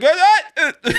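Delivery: sudden goofy squawks; silly stream noises. Shown as a demonstration. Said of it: "The noises that I fucking make".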